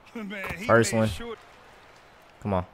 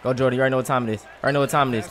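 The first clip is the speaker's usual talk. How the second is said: ordinary talk, nothing marked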